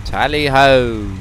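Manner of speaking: very slow hammed-up British accent; mock British tally ho. Mock British accent as the fighter squadron heads out. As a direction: drawl a cheerful British battle cry. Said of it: "How is my British accent".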